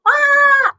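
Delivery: high loud startled ah sound; mock lizard threat cry; skink scare hiss. Shown as a demonstration. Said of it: "Can you open your mouth and go".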